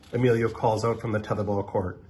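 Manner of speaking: ordinary delivery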